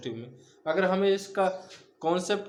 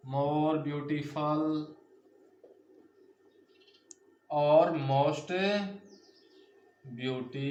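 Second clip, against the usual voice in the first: more beautiful and most beautiful drawn out very slowly, each word spaced; slow teaching demo; much slower than usual. Shown as a demonstration. Teacher slowly modelling comparative and superlative forms. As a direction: lay out the two forms slowly for the class. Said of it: "तो ये हमारा हो जाएगा".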